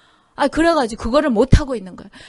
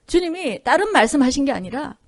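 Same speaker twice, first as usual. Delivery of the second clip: usual speaking voice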